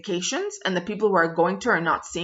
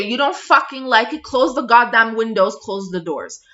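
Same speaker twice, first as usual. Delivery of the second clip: angry retort; 4 semitones higher, 6 dB louder than usual. Retold or imitated.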